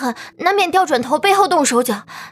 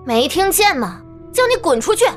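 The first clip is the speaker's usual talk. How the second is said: high, icy, biting dismissal; cold anger; 9 semitones higher than usual. Acted. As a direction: cut him off coldly. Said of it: "冯安宁冷冷说道".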